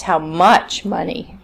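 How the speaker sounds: "much" made prominent, "money" trailing unstressed; stress on much. Shown as a demonstration. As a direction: punch "much" and drop away on "money". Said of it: "stress now needs to be on the n-, new information".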